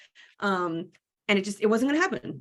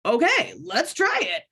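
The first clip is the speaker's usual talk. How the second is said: eager agreement; 9 semitones higher than usual. Retold or imitated. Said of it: "I was like".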